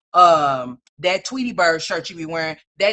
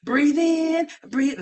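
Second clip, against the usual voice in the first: mock breathing coach; 6 semitones higher, much slower than usual. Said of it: "no, I'm just playing".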